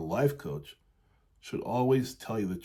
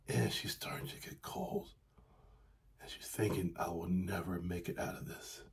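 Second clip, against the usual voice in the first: hopeless whisper; 4 semitones lower, 10 dB quieter than usual. A narrator's reading. Coach voices the freezing bird to clients, whispered despair. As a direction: drop to a soft whisper, lower, giving up.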